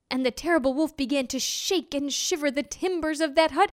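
plain reading voice

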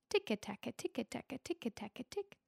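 tapping sound. A narrator's reading. Tapping sound of leprechaun's hammer.

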